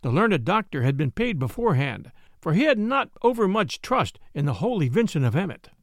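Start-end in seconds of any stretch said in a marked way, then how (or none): none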